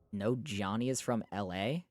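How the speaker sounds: ordinary talk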